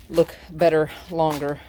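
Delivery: normal talking voice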